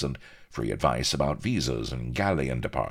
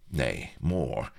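ordinary delivery

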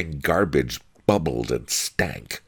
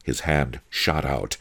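usual speaking voice

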